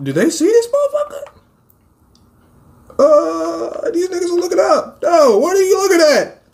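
high, squeaky alarm; high-pitched comic fright; mock panic. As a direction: squeal it as if just spotted. Alarmed that monsters look at him.

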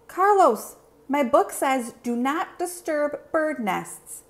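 wag a finger with your voice; scolding know-it-all. Acted. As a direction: classmate reading rules at Carlos. Classmate scolds Carlos.

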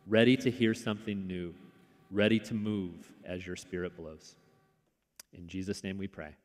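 soft, unhurried opening prayer. Acted. Pastor praying for the congregation, soft and reverent. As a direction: pray gently over the congregation.

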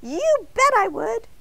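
a tiny voice bursting to say yes; eager donut voice. A narrator's reading. Arnie the donut accepting Mister Bing's offer, high and eager.

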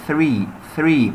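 three with a clear voiceless th; correct th. Shown as a demonstration. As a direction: model three with tongue between teeth. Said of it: "مش ثري ولا فري". (three بثاء واضحة)

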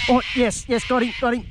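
yelling as the fish comes in; excited cheer. Real, not acted. Angler cheering his catch, high and thrilled.

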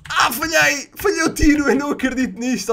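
frustrated gamer yelp, easing off at the end; exasperated disbelief. Real, not acted. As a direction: cry out at your own blunder. Missed his shot in game.

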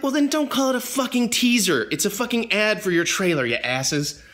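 high, exasperated retold griping; mock rant. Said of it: "I'm like".

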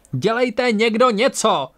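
high, drawn-out furious demand; angry yell. A narrator's reading. Tora demands action from the crew, angry yell. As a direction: yell for help, stretched and high. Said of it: "zařvala naštvaná Tora".

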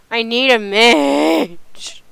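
loud, drawn-out plea; calling out. Real, not acted. Player begging the guys for a mage, calling out. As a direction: call out loudly, stretching the last word.

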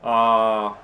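normal talking voice

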